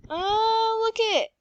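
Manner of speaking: sudden excited discovery; bright pointing-out exclamation. Real, not acted. Spots something in game.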